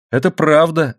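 a touch of emphatic agreement; exclamation. A narrator's reading. Wife agrees with son about husband's figure, exclaiming mildly. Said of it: "воскликнула миссис Моррелл".

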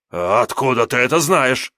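a cowardly demon bluffing loudly; panicky little demon. Acted. Imp demon voiced in story. (визгливый трусливый демон)

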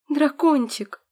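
high, loud excited squeal; delighted squeak. Acted. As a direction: squeal with delight at a baby dragon. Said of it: "пискнула я восхищённо".

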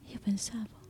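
ordinary talk

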